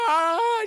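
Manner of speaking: high, loud imitated cry; mock wail. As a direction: wail as the horrified mother. Mother wails at Candy's story.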